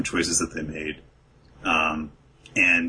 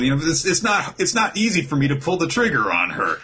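higher, rising squabble; heated comic banter; 10 semitones higher than usual. Retold or imitated. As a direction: bicker back and forth, playful and heated. Acted squad argument.

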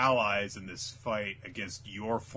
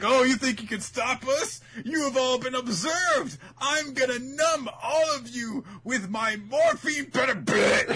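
very high, loud shouted threat; ranting villain; 12 semitones higher, 6 dB louder than usual. Acted. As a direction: shriek it like a hammed-up villain.

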